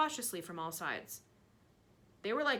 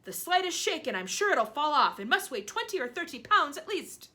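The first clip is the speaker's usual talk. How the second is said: loud warning; 7 semitones higher, 8 dB louder than usual. Acted. Aunt warning loudly.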